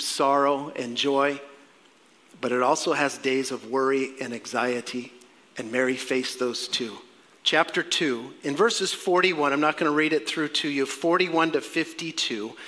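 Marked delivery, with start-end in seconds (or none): none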